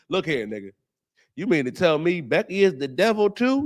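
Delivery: ordinary delivery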